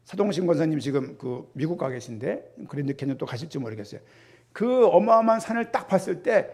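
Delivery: usual speaking voice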